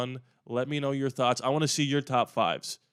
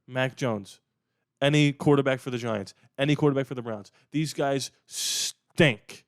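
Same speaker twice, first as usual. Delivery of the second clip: ordinary delivery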